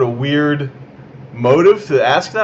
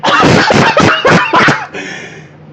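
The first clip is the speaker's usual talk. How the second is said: wild shrieking laugh; 6 dB louder than usual. Acted. Wild laugh mid rant.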